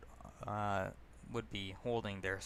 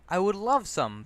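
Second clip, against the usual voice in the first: a bright, much louder and higher "I would love some"; cheerful; 9 semitones higher, 10 dB louder than usual. Retold or imitated. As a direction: jump at the offer of free pizza. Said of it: "I say cheerfully".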